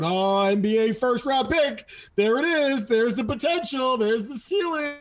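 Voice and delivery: high, breathless-fan hype, imitated; gushing. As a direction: gush like hyped-up fans, pitch climbing high. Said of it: "everyone's just gushing, going".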